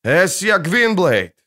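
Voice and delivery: loud, slow shout into the forest. A narrator's reading. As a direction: call out loudly and slowly. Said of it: "крикнул он снова".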